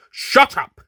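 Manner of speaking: loud high snapping shout; frustrated shout. Acted. Horse losing patience with the doctor, loud shout. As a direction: yell it in frustration. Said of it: "the horse shouted in frustration".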